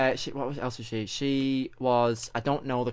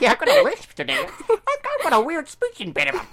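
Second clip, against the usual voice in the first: squeaky lisping guide voice, much higher, louder and faster; mock her impression with a silly lisp, pitched way up; mocking Cindy voice; 8 dB louder than usual. Teasing co-host, parodying her Cindy voice with a lisp. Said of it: "And now she's like".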